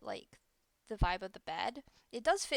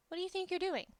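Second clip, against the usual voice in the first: higher, indignant telling-off of a game character; mock scolding; 9 semitones higher, 3 dB louder, much faster than usual. Real, not acted.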